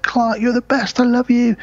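high, gushing loving praise; adoring wife. Retold or imitated. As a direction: gush over him like a proud wife. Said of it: "Mum's like".

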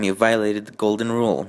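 usual speaking voice

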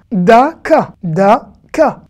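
daka said loud and long; modeled word. Shown as a demonstration. Teacher modeling a Hebrew word for learners, loud. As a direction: pronounce daka clearly, drawn out. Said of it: "teacher models word".

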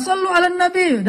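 high-pitched call to a quarrelling crowd; high, insistent mock call; peacemaker calling salawat. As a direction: imitate someone breaking up a bus row. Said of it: "kalau udah ramai di dalam damri marah-marah".

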